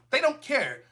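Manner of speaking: usual speaking voice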